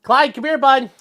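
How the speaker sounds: high, loud call to the dog. Real, not acted. Calling the dog over.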